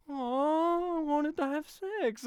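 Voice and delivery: high, drawn-out mock disappointment; pathetic sad whine. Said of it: "It was so pathetic".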